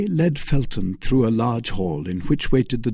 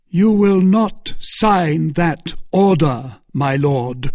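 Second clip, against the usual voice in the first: slow, higher, louder command; forceful warning; 7 semitones higher, 6 dB louder, slower than usual. Acted. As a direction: stop him with every word. Felton forbidding the signature.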